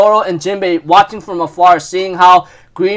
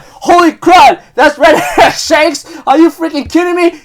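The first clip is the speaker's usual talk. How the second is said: hammed-up shouted shock; shocked outburst; 9 semitones higher, 4 dB louder than usual. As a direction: act out stunned alarm, pitched way up. Acting out Green Bull's shocked reaction.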